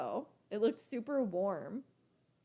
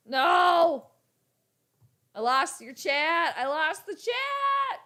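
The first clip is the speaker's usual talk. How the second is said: loud cry of dismay, climbing much higher; dismayed wail; 6 semitones higher, 6 dB louder, much slower than usual. Real, not acted. Streamer who just lost the chat, dismayed wail. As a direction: wail it slowly, stretched out and rising.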